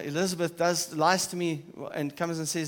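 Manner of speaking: usual speaking voice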